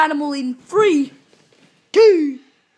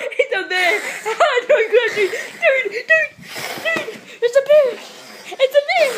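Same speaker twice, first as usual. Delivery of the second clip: laughing shock; 10 semitones higher than usual. Real, not acted. Bear suddenly appears in game.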